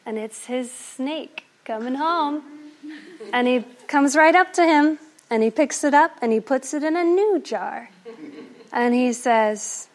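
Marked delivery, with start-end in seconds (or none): none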